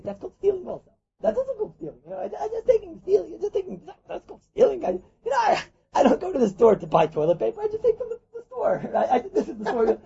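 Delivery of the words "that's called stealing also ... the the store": much higher, softer mocking voice; squeaky mocking impression of a petty thief. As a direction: play the sheepish pen thief lightly.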